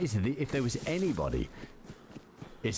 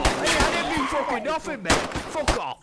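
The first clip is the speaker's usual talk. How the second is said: higher, louder British lad in a brawl; heated fake British accent; 11 semitones higher, 7 dB louder than usual. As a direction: pick a fight as a British bloke. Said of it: "I'm fucking talking like him".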